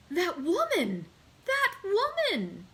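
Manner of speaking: high, slow, stricken repetition; horrified. A narrator's reading. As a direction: servant reeling at a ghost from his past. Servant recognising a woman, horrified.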